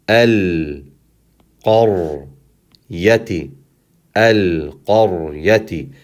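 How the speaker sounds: al, qar, yati apart with pauses; one syllable at a time. Shown as a demonstration. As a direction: linger on the l of al and r of qar. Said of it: "taking one vowel at a time".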